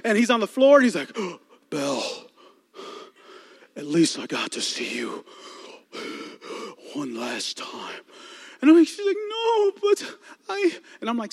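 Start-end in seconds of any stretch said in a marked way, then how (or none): 1.4-8.0 low, slow, fading dying voice; the Beast dying in Belle's arms, low and faint. Retold or imitated. 9.3-10.7 high, slow, sobbing plea; crying Belle. Retold or imitated. Belle crying over Beast.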